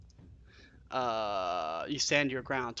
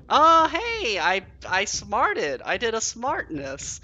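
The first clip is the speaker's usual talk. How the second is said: high, loud burst of delight, then slow and proud; giddy triumph; excited; 9 semitones higher, 8 dB louder, much slower than usual. Real, not acted. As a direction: squeal with surprise at your own luck. Delighted by a game success.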